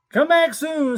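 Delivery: high, loud giraffe call. Acted. The giraffe calling to Sammy as he leaves. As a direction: sing it out like a giraffe. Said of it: "said the giraffe".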